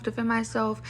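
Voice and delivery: usual speaking voice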